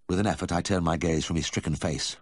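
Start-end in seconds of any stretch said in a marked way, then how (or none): none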